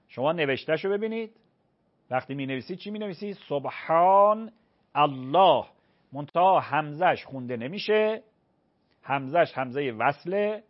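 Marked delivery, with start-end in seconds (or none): 3.5-5.6 سبحان drawn out slowly, then الله with its final h clearly pronounced; careful Arabic pronunciation of سبحان الله. Shown as a demonstration. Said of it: "ه باید تلفظ شود".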